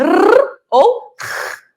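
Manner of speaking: first "R" trilled on the tongue tip, second "R" rasped in the throat; "R ou R": a long trill, then a guttural fricative; two R sounds. Shown as a demonstration. Teacher showing two sounds of R, trill then throat. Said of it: "eu tenho dois sons de R".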